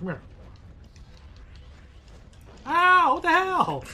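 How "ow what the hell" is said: high loud shocked outcry; startled yell. Real, not acted. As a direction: yelp as if suddenly hit. Hit in game.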